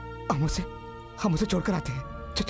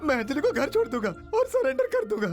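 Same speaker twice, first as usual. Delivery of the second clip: higher-pitched, urgent bargaining; desperate pleading; 5 semitones higher, faster than usual. Acted. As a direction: plead as if a life depends on it. Begging captors to release girl. (बेचैनी भरी गिड़गिड़ाहट)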